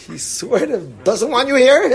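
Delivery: retelling an awkward plea to a friend while laughing. Retold or imitated. Laughing through a retold plea.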